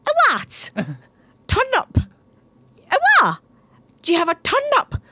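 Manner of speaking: very high, squeaky incomprehension; baffled shopkeeper. Retold or imitated. Shopkeepers not understanding mum's turnip, squeaky high. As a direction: squeal it like you can't understand her.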